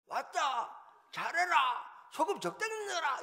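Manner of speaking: high, booming Gyeongsang-do holler; hammed-up loud returning customer; acted yelling. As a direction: announce yourself and shout orders at the cook. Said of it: "소리 지르는 건 좀".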